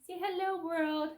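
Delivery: squeaky pet-voice greeting; high dog-talk voice. Pretend. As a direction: coo it like talking for your pet.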